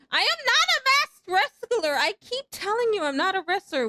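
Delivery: loud, high-pitched protest; high exasperated denial, easing slightly; indignant outburst. Real, not acted. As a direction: shout down the teasing in exasperation. Indignant denial of chat's teasing.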